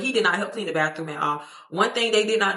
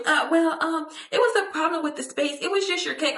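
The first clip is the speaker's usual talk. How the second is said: higher, hesitant, meek excuse; mocking the roommate; 9 semitones higher than usual. Roommate mimicked making a weak excuse for the cake, high and hesitant. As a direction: whine it like a flustered roommate caught out. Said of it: "So she was".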